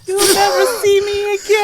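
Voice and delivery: loud, high hammed-up wail; over-the-top tearful farewell; mock wailing. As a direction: cry it out in exaggerated despair. Co-host mockingly acts the sad farewell.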